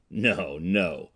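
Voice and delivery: a scornful brush-off; contemptuous. A narrator's reading. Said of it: "said Clason contemptuously".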